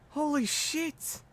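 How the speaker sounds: slow, high, drawn-out disbelief; exasperated. Acted. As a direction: sigh it out in disbelief. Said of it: "playing my reaction up".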